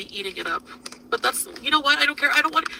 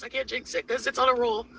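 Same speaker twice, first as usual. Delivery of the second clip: ordinary talk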